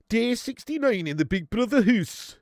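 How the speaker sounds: slow, mock Geordie reality-show narrator; Geordie accent. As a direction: intone it like a Geordie Big Brother voiceover. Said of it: "He's a Geordie".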